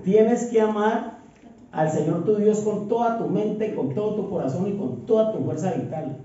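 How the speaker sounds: solemn quoting of Jesus; scripture reading. Acted. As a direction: proclaim Christ's words with authority. Quotes Jesus's commandments.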